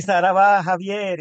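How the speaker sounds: loud, high rallying cry; demonstrated call. Shown as a demonstration. Host shows guest what to cry at the end, loud call. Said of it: "Puedes, puedes decir".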